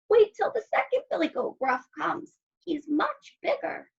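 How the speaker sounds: high little goat voice; very small voice. A narrator's reading. Littlest goat putting off the troll, small high voice. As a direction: bargain in a tiny high voice. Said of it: "in his very small voice".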